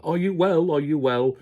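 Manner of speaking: high, loud sing-song imitation of a friendly greeting; teasingly ape an over-cheerful welcome; mock greeting. Mocking Nick's arrival greeting.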